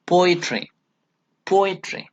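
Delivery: "poetry" with PO high, the rest falling away; stress on first syllable. Shown as a demonstration. Teacher repeats the dactyl example, stress on first syllable. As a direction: hit PO in "poetry", let "etry" drop. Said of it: "is not given importance".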